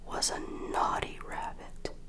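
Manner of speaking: plain reading voice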